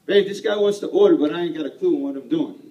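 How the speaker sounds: husband calling his wife about a buyer, excited. Retold or imitated. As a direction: call home excited but lost. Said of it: "I'm like".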